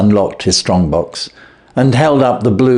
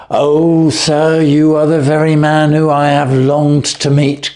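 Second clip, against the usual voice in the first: eager cry; 6 semitones higher than usual. Acted. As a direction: greet him like the man you've longed for. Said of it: "cried the little fellow".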